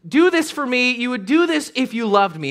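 high, loud wheedling voice; pushy manipulator. Retold or imitated. Dater pressuring a partner, mock wheedling. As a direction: coax like a manipulative date.

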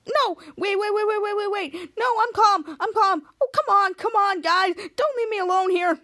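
high, rapid-fire begging; frantic pleading. Retold or imitated. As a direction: plead with them not to leave. Captain Man begs them to stay.

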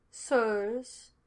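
dry, formal butler's address. A narrator's reading. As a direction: announce yourself with understated dryness. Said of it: "Aldous said dryly".

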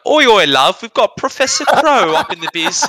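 high, loud British caricature; mock British accent. Australian imitating British coworkers, hammed-up British accent. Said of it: "every British person I worked with".

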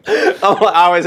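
real laughter, high-pitched through the words; laughing. Real, not acted. Musician cracking up at the host's straight-faced joke, laughing. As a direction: crack up while talking.

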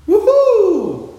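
high loud whoop of joy; cheer. Retold or imitated. Office worker seeing sun, loud high whoop.

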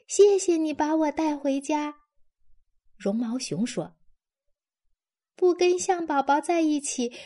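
high, small teddy bear voice; the bear pleading to be taken home; lost teddy bear. A narrator's reading. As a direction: lightly voice a lost little toy bear.

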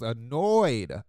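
"annoyed" stretched out, loud; stress on annoyed. Real, not acted. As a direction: drag out the word "annoyed" for emphasis. Emphasizes the worker's mood.

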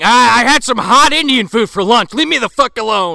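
high, loud flustered excuse; high loud defensive Tex. Acted. Tex brushing off the sweating question, loud and high. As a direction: snap back defensively.

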